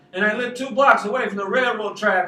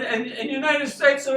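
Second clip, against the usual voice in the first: ordinary delivery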